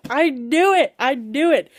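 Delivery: triumphant gloat. Real, not acted. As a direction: crow over being right. Gloating after her guess proved right.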